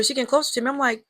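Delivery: ordinary talk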